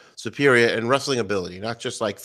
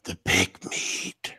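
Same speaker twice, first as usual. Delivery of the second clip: whisper; 7 semitones lower, 9 dB quieter, much slower than usual. Acted. Relishing big wrestlers.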